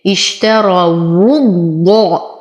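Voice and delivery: اشتروا الضاء with a doubled, heavy ض; slow tajweed demo. Shown as a demonstration. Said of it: "فهي تخرج من الشفتين". (تشديد الضاد مع التفخيم)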